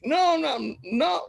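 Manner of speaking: high-pitched mock denial; hammed-up high protest; tearful denial. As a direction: play caught out, denying it in a high voice. His denial of crying, acted.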